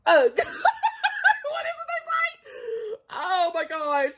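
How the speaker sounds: slow, high, half-laughing, half-crying. Real, not acted. Vlogger overwhelmed by the book, laughing through tears.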